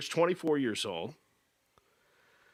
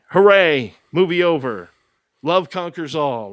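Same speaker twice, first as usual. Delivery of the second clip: loud, higher sarcastic hooray; mock cheer; 3 semitones higher, 8 dB louder than usual. Mocking the movie's happy ending with a loud cheer. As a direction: cheer the fake ending loudly.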